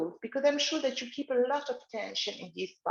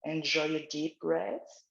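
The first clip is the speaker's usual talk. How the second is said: guided relaxation; 4 semitones lower than usual. Acted. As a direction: lead gently, eyes closed. Leads the exercise again.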